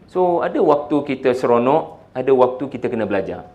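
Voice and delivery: normal talking voice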